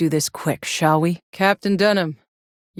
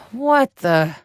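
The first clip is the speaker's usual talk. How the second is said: startled; 4 semitones higher, 3 dB louder than usual. Acted. Stumbling on a strange sight.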